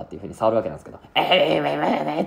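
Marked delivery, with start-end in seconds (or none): 1.2-2.2 hammed-up shudder of being shocked; imitated electrocution. Kenchio grabs the electric fence, mock jolting cry. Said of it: "ケンチオが感電する".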